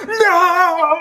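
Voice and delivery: long, high crying wail; mock anguished wail. Friend watching her car get trashed, mock anguished wail. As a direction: wail helplessly, hammed up.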